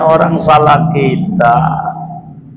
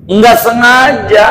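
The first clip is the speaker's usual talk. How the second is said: emphatic insistence; 8 semitones higher, 3 dB louder than usual. Real, not acted. Preacher insists loudly.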